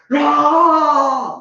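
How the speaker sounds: loud high shouted serve grunt; a shouted tennis grunt; imitated serve grunt. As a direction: roar like an old man serving. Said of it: "he's screaming at every serve".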